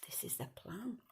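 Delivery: breathy aside whisper. A narrator's reading.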